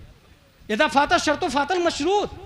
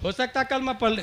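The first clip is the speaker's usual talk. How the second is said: much softer mocking imitation of objectors; 5 dB quieter than usual. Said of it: "अच्छा, कुछ लोग क्या कहेंगे". (विरोधियों की धीमी, नकली नक़ल)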